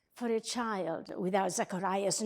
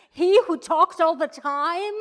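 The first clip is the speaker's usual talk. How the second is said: loud, high disbelief; raised voice, rising in disbelief; incredulous; 6 semitones higher, 13 dB louder than usual. Acted. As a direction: exclaim it as an amazed question. Incredulous about her talkative husband struck dumb.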